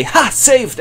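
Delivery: high, loud cartoon cheer of escape; triumphant prisoner. Freed prisoner jumping clear, high loud cartoon cheer. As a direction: whoop like you just got away.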